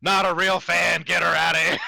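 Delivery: loud, slow mocking yell; mock outraged shout. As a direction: shout them out of the room. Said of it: "It's like".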